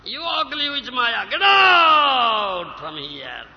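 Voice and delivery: loud, slow "get out" at the witch; angry shout. Acted. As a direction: drive her away with scorn. Devotee drives away Maya.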